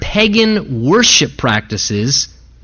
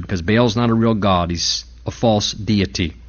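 ordinary talk

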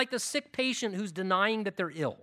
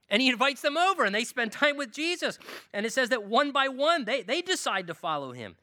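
ordinary delivery

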